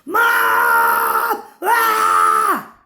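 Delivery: desperate full-voiced yell, high and loud; panicked shout for mom. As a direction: scream for your mother in terror. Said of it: "and then I yelled".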